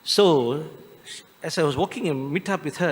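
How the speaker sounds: ordinary delivery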